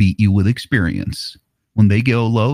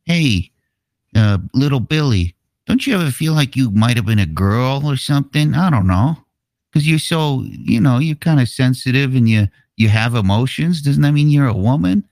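put-on higher-pitched voice of a kid nudging a friend; coaxing kid; 7 semitones higher than usual. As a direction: play a pushy kid lightly, pitched up. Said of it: "one of the kids that has been trained to be a troon is like".

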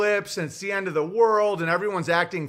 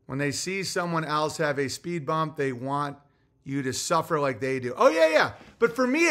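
ordinary talk, nothing marked

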